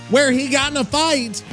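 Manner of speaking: ordinary talk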